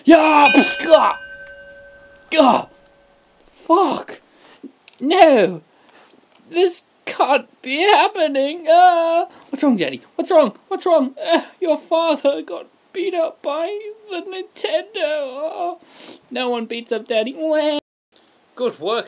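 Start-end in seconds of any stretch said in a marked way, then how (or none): 0.1-8.7 high, loud screams of despair; anguished screaming. Acted. Father screams as he loses to his rival, anguished. Dad's meltdown in skit. 8.7-17.8 put-on high, loud wailing child voice; crying kid. Little son cries over his beaten father, high wail.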